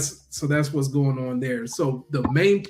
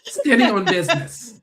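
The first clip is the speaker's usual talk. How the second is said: laughing echo; 6 semitones higher, 5 dB louder than usual. Retold or imitated. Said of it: "echoes laughing".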